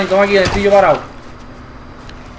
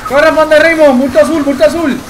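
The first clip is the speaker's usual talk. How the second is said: high, loud panicked game call; urgent shout; 5 semitones higher, 5 dB louder, slower than usual. Real, not acted. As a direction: yell at teammates to run. Grita que corran en partida. (grito urgente y agudo)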